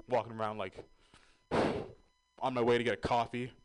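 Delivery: ordinary talk, nothing marked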